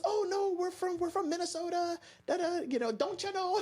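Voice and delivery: mock Minnesota accent, high and singsong. Tourist mom saying they're from Minnesota, in a mock Minnesota accent. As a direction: lay on a Minnesota accent, don't you know. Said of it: "you know the Minnesota".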